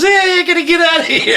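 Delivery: high, hammed-up alarm; mock panic. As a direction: play a comic frightened retreat. Acting out a panicked retreat from water.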